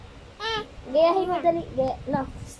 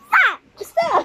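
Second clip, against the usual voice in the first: sharp, loud, high stop; playful loud shout at a child; 10 semitones higher, 8 dB louder than usual. Pretend. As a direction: yell it out like telling off a pretend dinosaur. Parent mock yelling at dinosaur game.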